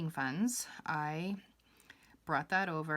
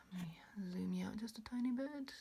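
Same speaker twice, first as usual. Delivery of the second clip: quick hushed whisper, a bit higher; whispered aside; 5 semitones higher, 4 dB quieter than usual. Real, not acted. Vlogger reframing the shot, whispering a quick aside to herself. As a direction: murmur it while nudging the camera.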